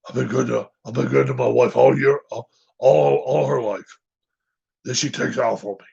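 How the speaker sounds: imitating Coach Orgeron. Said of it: "If I try to do his voice".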